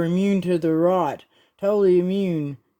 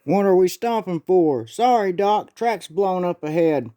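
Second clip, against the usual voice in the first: ordinary delivery, nothing marked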